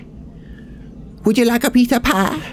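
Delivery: goofy high-pitched comic voice; silly put-on voice, jumping from hushed to high; Adam Sandler. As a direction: put on a daft, high impression voice. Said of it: "I'm gonna do my Adam Sandler voice".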